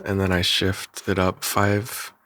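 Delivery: ordinary talk, nothing marked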